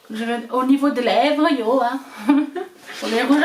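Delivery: playfully hammed-up Alsatian accent on "niveau des lèvres". Shown as a demonstration. Vlogger teasing her own Alsatian accent to viewers, mock Alsatian. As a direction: lean into a mock Alsatian lilt, joking with viewers. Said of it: "mon accent alsacien, vous l'entendez beaucoup".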